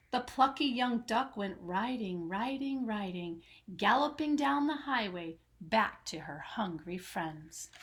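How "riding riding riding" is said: fading riding. A narrator's reading. Repeat fading.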